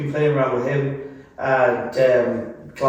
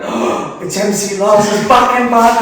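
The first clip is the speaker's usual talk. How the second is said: excited fan; 8 semitones higher, 7 dB louder than usual. Retold or imitated. Excited fans recognising band.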